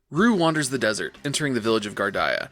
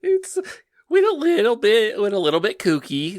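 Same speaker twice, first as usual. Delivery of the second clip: much higher, goofy squeak; squeaky silly voice; 11 semitones higher, 6 dB louder than usual. Acted. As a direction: squeak it out like a cartoon. Silly high voice.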